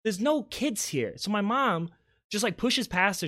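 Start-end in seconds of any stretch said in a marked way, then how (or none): 0.0-1.1 retold exasperation, pitched well up; exasperated denial. Aunt telling Tammy there are no kids, exasperated denial. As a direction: imitate a baffled protest. Aunt voiced in acted quarrel.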